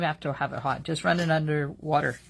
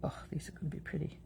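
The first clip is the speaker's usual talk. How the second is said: whispering; 11 dB quieter, much faster than usual. Real, not acted. Quiet aside while working.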